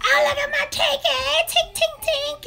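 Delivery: put-on squeaky baby voice cooed at a hen; high cooing baby talk. Cooing up high to her pet chicken. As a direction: coo up high like talking to a pet.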